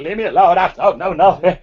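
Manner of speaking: high, loud acted shriek of panic. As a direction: shriek like someone running away in fright. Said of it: "He's shrieking at the top of his voice".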